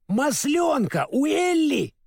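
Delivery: high, stretched-out wail of alarm; frightened cry. A narrator's reading. Scarecrow fearing rust with oilcan gone, frightened cry. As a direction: wail it in high-pitched panic. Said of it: "в испуге закричал Страшила".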